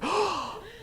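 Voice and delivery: sharp high gasp of relief; relieved gasp. Retold or imitated. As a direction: gasp as if finally freed. Relieved gasp when kid sleeps.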